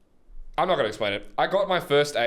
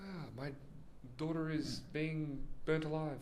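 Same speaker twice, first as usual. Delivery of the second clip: soft, higher, halting voice; mock villager lament; 4 semitones higher, 12 dB quieter than usual. Villager tells a neighbour of tragedy, softly mock-mournful. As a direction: lament quietly, with a mock-casual air.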